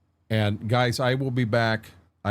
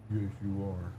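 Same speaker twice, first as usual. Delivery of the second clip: soft cooing pet voice, much quieter; talking to a dog; 7 dB quieter than usual. Real, not acted. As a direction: ask the dog sweetly and softly. Soft talk to his dog.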